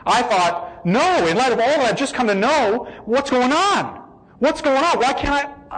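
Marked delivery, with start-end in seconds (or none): 0.9-2.9 retold panic. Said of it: "It freaked the daylights out of me". 3.0-4.0 raised, alarmed pitch on the question; frightened plea; panicked question. Retold or imitated. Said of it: "It freaked the daylights out of me". 4.4-5.8 frightened cry rising in pitch; rising panic. Retold or imitated. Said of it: "It freaked the daylights out of me".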